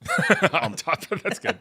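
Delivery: high, drawn-out laugh through the word. Real, not acted. Amused co-host echoing a made-up word, laughing. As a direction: repeat it through helpless laughter.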